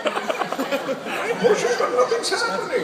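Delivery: slow, high-strained mock effort; constipated Batman. Batman on the toilet with nothing happening, comic straining. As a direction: strain every word out, hammed-up. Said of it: "someone who really constipated".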